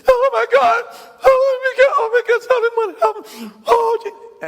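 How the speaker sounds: very high, loud, frantic shrieking; hammed-up panicking husband, shrill and high; hysterical panic. Husband panicking at crying wife.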